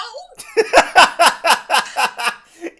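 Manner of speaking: loud, high burst of laughter; helpless cackling; big laugh. Real, not acted. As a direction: crack up uncontrollably. Cracking up at the impression.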